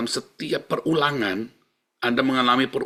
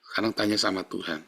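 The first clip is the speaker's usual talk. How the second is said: usual speaking voice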